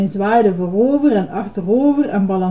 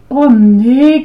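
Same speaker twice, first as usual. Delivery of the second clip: loud, higher groan of dismay; 4 semitones higher, 9 dB louder than usual. A narrator's reading. Girl hears all flavours are coming, groaning. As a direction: groan it out in protest. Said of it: "kreunde Betty".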